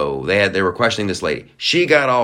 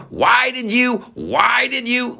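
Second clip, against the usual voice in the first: mocking whiny suspect; slower than usual. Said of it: "When people start going".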